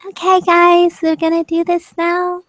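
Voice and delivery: high, bright cutesy voice; high and sweet like a beauty vlogger. Shown as a demonstration. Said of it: "my really cute voice".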